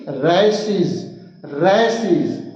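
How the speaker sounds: रेसिस drawn out, ending in a buzzed -siz; teacher's drill of रेसिस with a long "seez" ending. Shown as a demonstration. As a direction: slowly model रेसिस for repeating, last s as z. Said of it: "Repeat with me".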